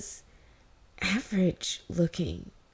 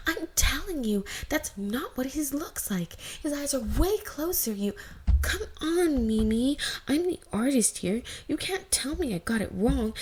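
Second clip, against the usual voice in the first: put-on higher, louder squabbling girls' voices; two sisters squabbling over a fan drawing, higher and louder; girls arguing; 8 semitones higher, 5 dB louder than usual.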